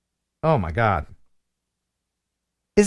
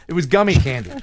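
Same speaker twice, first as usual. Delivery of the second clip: normal talking voice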